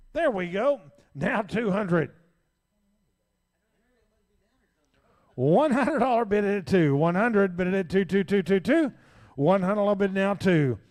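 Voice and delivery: rapid rhythmic auction chant; frantic trading floor shouting. Real, not acted. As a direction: rattle off the bids, pushing the room. Auctioneer calls the bids.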